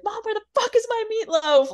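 high, loud mock yell at Mom; demanding movie impression. Quoting a Wedding Crashers scene at Mom, demanding high yell. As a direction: holler like a spoiled grown child. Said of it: "like that scene from fucking Wedding Crashers".

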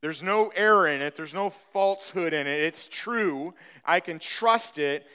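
ordinary delivery

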